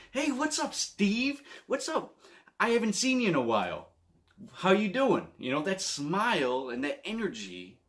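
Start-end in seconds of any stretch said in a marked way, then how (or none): 0.1-5.3 put-on much higher, beaming hello to an old friend; big smiling greeting. John greeting a coworker with a big smile, much higher and beaming. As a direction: greet him like you're thrilled to see him. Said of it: "with a big smile and being like".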